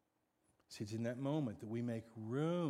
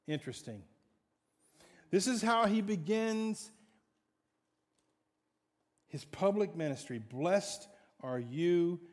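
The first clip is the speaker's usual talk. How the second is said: loud, higher preaching; full-force preaching; 6 semitones higher, 8 dB louder than usual. Acted. Preacher drives home the blessing to the congregation, loud and high. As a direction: preach it with fire.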